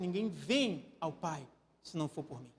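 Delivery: gentle invitation to come; soft correct version. Shown as a demonstration. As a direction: say "vem" tenderly and low. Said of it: "Ele disse".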